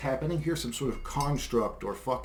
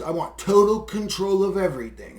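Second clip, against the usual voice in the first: mock power-hungry psychopath; 4 semitones higher, 5 dB louder than usual. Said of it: "if you're thinking in terms".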